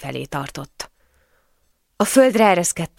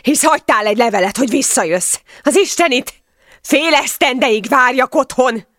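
loud, high outburst; furious shouting; 7 semitones higher, 5 dB louder than usual. A narrator's reading. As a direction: hurl the reproach at full voice. Husband keeps yelling at wife. (dühös, szemrehányó ordítás)

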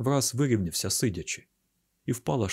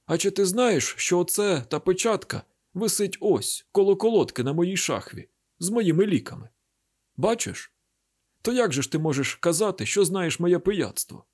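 much higher, heated voice; indignant challenge; 8 semitones higher than usual. A narrator's reading. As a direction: demand an answer, pitched high throughout. Father indignantly challenges his son.

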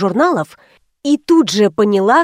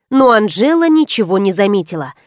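usual speaking voice

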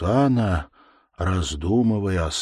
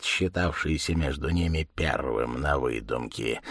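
ordinary delivery, nothing marked

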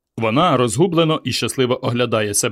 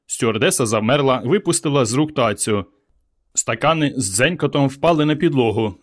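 ordinary delivery

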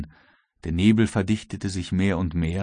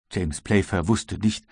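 ordinary delivery, nothing marked

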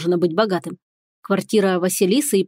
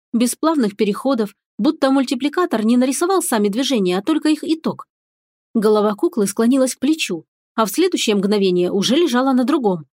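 usual speaking voice

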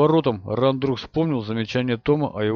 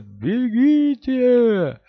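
stretched high desperate yell; 11 semitones higher, 5 dB louder, much slower than usual. Acted. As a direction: cry it out loud and slow. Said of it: "но даже в вопле можно было разобрать".

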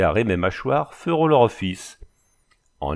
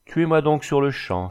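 higher-pitched, defiant exclamation; disgusted outcry; 6 semitones higher than usual. A narrator's reading. Prisoner daring his captor to kill him, disgusted. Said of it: "s'écria Dubreuil avec un geste de dégoût".

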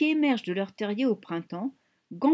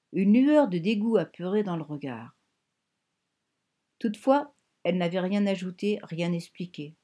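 ordinary delivery

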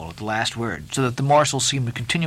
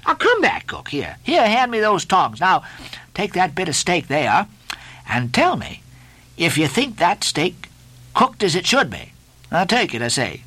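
high, insistent ordering; Stubb, commanding mate; 7 semitones higher than usual. A narrator's reading. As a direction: order the cook back.

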